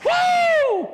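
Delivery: high whooping cheer; excited fan whoop; cheering shout. Retold or imitated. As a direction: let out a big stadium woo. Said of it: "I'm like".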